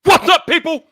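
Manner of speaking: loud hyped-up livestream opener, shouted and stretched out; hype intro shout. Acted. Host opening his Friday night livestream to the chat, a loud hype shout. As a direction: rear back and blast the intro like a ring announcer. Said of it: "deliver an intro as only I can".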